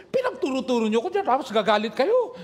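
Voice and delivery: hammed-up Ilocano teacher; playful retold protest; mimicking angry teacher. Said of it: "Ginaya ko yung style niya".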